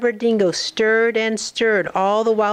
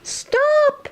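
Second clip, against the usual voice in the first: mock high shout. Animals cry out to stop the dingo, high mock shout. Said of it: "Are they saying".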